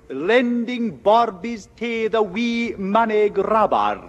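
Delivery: slow, broad Scottish accent put on for a joke; mock Scottish accent. A visitor teases the boy in a comic Scottish accent. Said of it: "That's Scottish".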